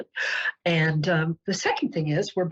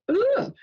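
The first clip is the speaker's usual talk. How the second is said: high-pitched noise of disgust; disgusted ugh. Real, not acted. Reacting to a blueberry energy drink, disgusted. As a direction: let out a high, revolted ugh.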